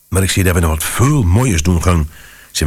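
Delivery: ordinary delivery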